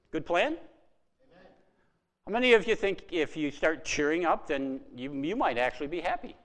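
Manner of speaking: usual speaking voice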